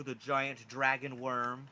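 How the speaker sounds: usual speaking voice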